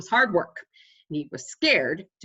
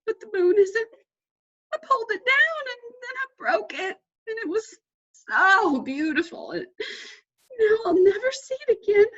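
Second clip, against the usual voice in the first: high, louder tearful wail; sobbing; 5 dB louder than usual. A narrator's reading. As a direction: cry out the loss. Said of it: "sobbed Mole".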